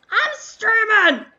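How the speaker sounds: high, drawn-out yell of exasperation; exasperated yell. Real, not acted. Streamer venting about calls during the stream, exasperated yell. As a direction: yell it long and loud, fed up.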